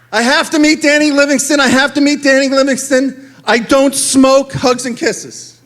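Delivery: mock swooning girl reader; lovestruck girl fan. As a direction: read it like a smitten teenage girl. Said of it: "Denise Cantrell, North Platte, Nebraska".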